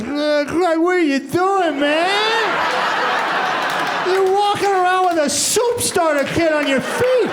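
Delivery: high, incredulous mock voice, laughing; baffled onlooker. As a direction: play the stunned friend who can't believe it. Someone finds him at night.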